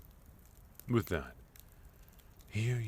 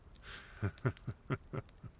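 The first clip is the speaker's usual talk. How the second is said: airy laugh; 8 semitones higher, 3 dB quieter than usual. Acted. Host amused by ambush plan.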